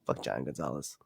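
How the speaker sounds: usual speaking voice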